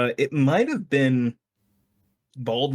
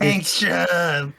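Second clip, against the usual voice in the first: a nasal yelled name, imitating Mark; hammed-up nasal shout of the name; nasal shout imitation; 4 semitones higher, 4 dB louder than usual. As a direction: call the name through the nose, mock yell. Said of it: "only when he shouted Angstrom".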